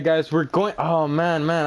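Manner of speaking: ordinary delivery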